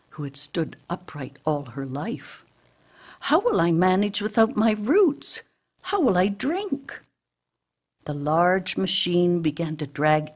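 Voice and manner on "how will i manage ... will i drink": high-pitched, anxious questions; worried. A narrator's reading. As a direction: ask higher, fretting for an answer. Emily worries without her roots.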